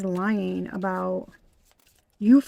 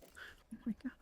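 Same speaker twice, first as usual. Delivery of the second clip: whisper; 2 semitones higher, 21 dB quieter than usual. Real, not acted. Stunned whisper at reading.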